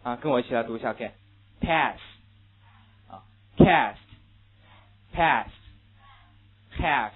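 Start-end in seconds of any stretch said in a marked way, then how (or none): 1.6-7.1 American "Pass", "cast", "half" with a flat short a like "pess"; teacher modelling the American vowel; American pronunciation. Shown as a demonstration. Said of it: "美国人会怎么来读".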